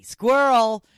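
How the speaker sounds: loud, high mock yell; distracted outburst. Podcaster joking about losing her train of thought, mock yell. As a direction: blurt it out as a sudden comic distraction.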